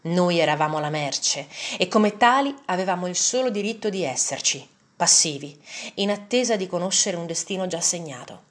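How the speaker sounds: ordinary delivery, nothing marked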